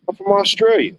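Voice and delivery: high, hammed-up Australian accent. Stranger answering where he's from, in a hammed-up Australian accent. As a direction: mimic the Aussie stranger's accent. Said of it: "where your accent's from".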